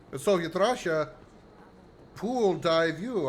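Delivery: slow, hammed-up Russian accent. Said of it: "I could use Russian accent".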